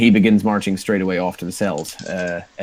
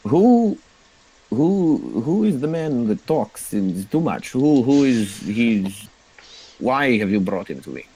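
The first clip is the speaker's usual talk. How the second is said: drow boss; 3 semitones higher than usual. Acted. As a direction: demand answers like a bored boss. GM voices drow leader questioning.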